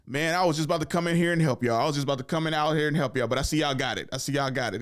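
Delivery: lazy uncle feigning surprise at the finished work; mock fake-helpful uncle. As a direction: play the uncle pretending he meant to help. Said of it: "They'd be like".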